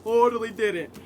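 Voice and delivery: playful sarcastic denial. Pretend. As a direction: pretend you never said it, through a laugh. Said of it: "I totally didn't say that earlier".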